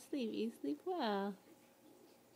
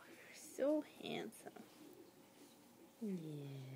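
whisper; 5 semitones lower, 7 dB quieter than usual. Real, not acted. Soothing baby to sleep.

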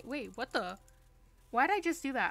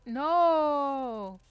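loud, drawn-out yell, higher pitched; long shouted "no"; 4 semitones higher, 11 dB louder than usual. Real, not acted. Player crying out mid-game in protest, long loud no. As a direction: yell it out and hold the vowel.

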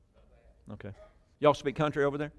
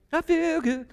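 high, playful feel-good exclamation; exuberant outburst. Acted. Preacher answering his own question, playfully exuberant. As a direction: sing out pleasure in a silly high voice. Mimics a body saying how it feels.

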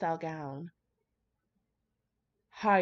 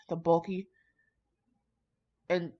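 plain reading voice

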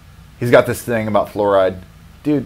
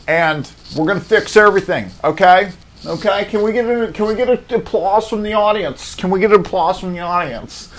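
Steve Harvey impression; 10 semitones higher than usual. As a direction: work the studio audience. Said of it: "Steve Harvey is like".

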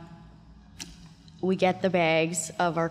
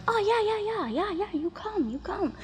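much higher, coaxing "yeah yeah, you come"; eager hooker. Retold or imitated. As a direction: play the eager street girl calling him over. Hookers voiced in a story.

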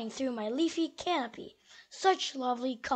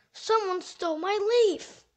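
alarmist doom warning; 6 semitones higher, 5 dB louder than usual. Acted. Squirrel reports the theft.

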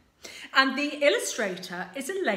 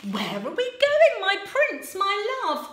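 high, loud and quick; eager princess, higher and louder; impatient Sue; 8 semitones higher, 7 dB louder than usual. Acted. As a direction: hurry him along.

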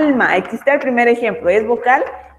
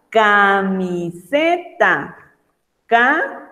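camiseta stretched out syllable by syllable, very slow; slow syllable demo; much slower than usual. Shown as a demonstration. Teacher to young pupils, splitting camiseta into syllables. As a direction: spell out camiseta slowly for the kids. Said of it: "Aquí tenemos una consonante y una vocal".

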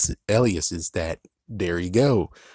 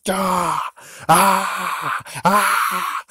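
high, loud frustrated screams; frustrated yells; 11 semitones higher, 5 dB louder, much slower than usual. Real, not acted. Fan exasperated at the unconnected villains, screaming high. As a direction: let out three high cries of exasperation. Frustrated outburst over no connections.

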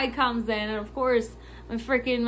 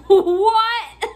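loud, high-pitched disbelieving "what"; incredulous outburst; 8 semitones higher, 10 dB louder than usual. Retold or imitated. Clerk reacting to a baffling caller, loud disbelief. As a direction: burst out with it, half laughing.

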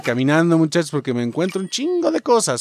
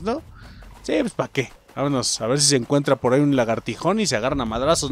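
usual speaking voice

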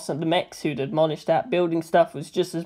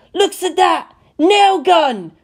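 much higher, louder delighted cries; thrilled boy spotting a nail gun; unbridled joy; 11 semitones higher, 7 dB louder, slower than usual. A narrator's reading. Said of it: "with such unbridled joy".

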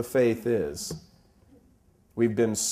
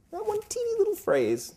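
mock-tiny squeak. Real, not acted. Preacher mocking how small the phrase is, squeaky high. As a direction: make the phrase sound tiny.

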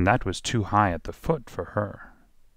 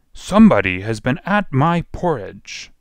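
lightly voiced gruff bear, loud and raised; gruff huge bear; 5 semitones higher, 6 dB louder than usual. A narrator's reading. Huge bear finds his porridge disturbed, gruff and loud. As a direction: boom it out as a big gruff bear. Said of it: "gruff voice".

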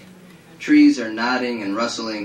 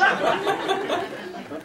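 put-on high girlish giggle; 4 dB quieter than usual. Ed as Crystal laughing.

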